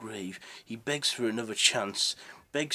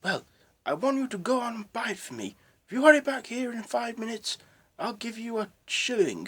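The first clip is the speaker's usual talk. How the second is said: much higher, fast and louder with joy; overjoyed; 7 dB louder, faster than usual. Acted. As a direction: rush the offer out in delight. Overjoyed Scrooge orders turkey.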